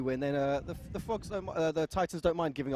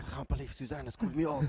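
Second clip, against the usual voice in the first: mock French accent, lightly put on; a clumsy hint of French, played for laughs; bad French accent. Commentator mocking a player's complaint, bad French accent. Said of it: "That's a really bad French accent".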